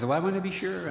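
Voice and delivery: lightly voiced earnest old dad. Father insists on accepting Christ again.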